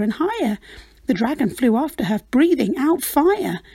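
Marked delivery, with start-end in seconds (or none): none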